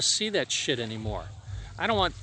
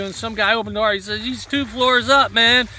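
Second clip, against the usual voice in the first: put-on higher, louder excited drunk story; drunk friend retelling; 5 semitones higher, 7 dB louder than usual. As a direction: tell the night like a giddy drunk.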